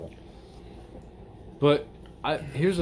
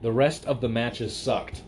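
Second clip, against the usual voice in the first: slow, leaning on each word; slow emphatic repeat; slower than usual. Shown as a demonstration. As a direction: drag it out for emphasis. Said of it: "repeats comment with emphasis".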